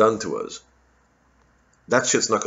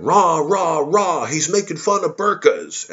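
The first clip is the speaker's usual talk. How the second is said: mock cheering fan; 7 semitones higher, slower than usual. Comedian mimics fans cheering his burqa jokes.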